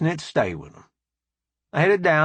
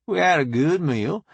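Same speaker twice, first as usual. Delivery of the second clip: ordinary delivery, nothing marked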